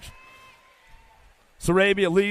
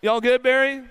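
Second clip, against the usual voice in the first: calling out; 5 semitones higher than usual. Real, not acted. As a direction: check on her across the track. Calling to fallen rider.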